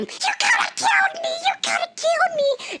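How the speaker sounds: very high-pitched furious shriek; retold scream. Injured woman to the boat driver, screaming. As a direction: re-enact screaming at someone. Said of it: "and I scream at him".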